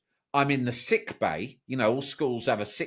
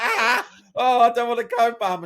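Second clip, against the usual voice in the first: scared whine; 11 semitones higher, 5 dB louder than usual. Retold or imitated. Boy pleading with mum, retold.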